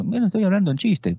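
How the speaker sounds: high, light teasing voice; playful joking tone. Shown as a demonstration. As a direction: make it clear you're kidding. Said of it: "tiene que apuntalar esa idea".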